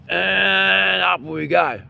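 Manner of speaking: high, very slow rising words; slow lift. Shown as a demonstration. Teacher lifting the energy up for the class. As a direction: draw it out as the hands rise. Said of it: "demonstrating the lift".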